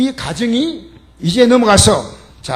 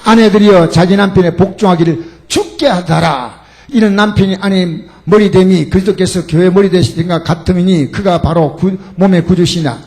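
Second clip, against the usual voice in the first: louder, declaimed Bible verse; loud scripture reading; 8 dB louder than usual. Acted. Preacher reading Ephesians with the congregation, declaiming. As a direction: proclaim each phrase to the congregation. Scripture read aloud forcefully.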